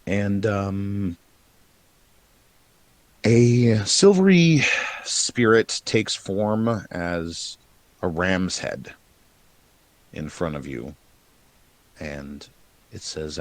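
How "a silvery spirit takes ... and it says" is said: slow, hushed scene-setting; game master narration. Acted. GM sets the scene slowly.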